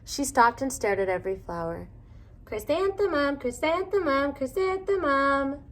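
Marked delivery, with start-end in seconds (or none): 2.4-5.6 the flowers whisper-call her name, lilting. A narrator's reading. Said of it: "the flowers seemed to say".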